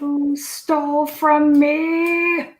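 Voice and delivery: high, slow, drawn-out goblin whine; slurring drunk goblin. Acted. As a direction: wail drunkenly, stretching the words. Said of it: "start to slur their words a little bit".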